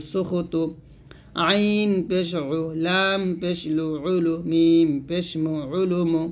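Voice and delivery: measured recitation with stretched letter names; Arabic spelling drill. Shown as a demonstration. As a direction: recite letter by letter for pupils to follow.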